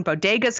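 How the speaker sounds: "Bodega's" said like the word for a corner store; mispronounced surname. Real, not acted. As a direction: say "Bodega's" with a d where the y belongs. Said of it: "you have pronounced it Bodega".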